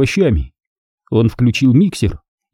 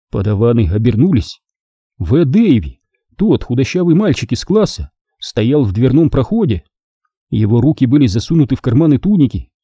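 ordinary delivery, nothing marked

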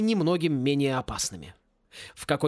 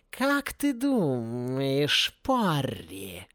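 insinuating; 2 semitones lower, much slower than usual. A narrator's reading. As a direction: stretch every word out coaxingly, like a cat. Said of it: "вкрадчивый голос".

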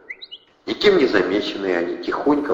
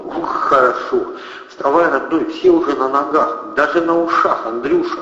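urgent rousing; 7 semitones higher than usual. A narrator's reading. Kristina urging Andrey to get up.

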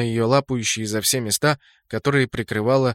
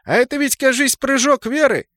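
much higher, louder gleeful joke; cheerful quip; 12 semitones higher, 5 dB louder than usual. Acted. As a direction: toss it off gleefully mid-fight. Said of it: "весело бросил".

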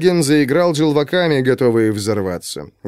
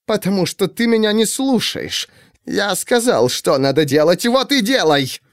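rough husband snapping, pitched up; irritated higher-pitched rebuke; raised angry voice; 8 semitones higher than usual. A narrator's reading. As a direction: bark it at the wife. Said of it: "Ты что на меня кричишь?".

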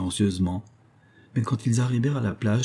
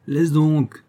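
a louder, higher cry of irritation; vexed exclamation; 6 semitones higher, 4 dB louder than usual. Acted. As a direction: throw it out in annoyance. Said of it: "s'écria Jacques dépité". (s'écrier, dépité, plus fort et plus aigu)

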